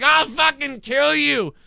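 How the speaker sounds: high, slow mock-furious scream; road rage threat. Road rager threatening a driver at his window, hammed-up scream. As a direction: ham up a raging driver yelling through the window. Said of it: "they come at your window and they're like".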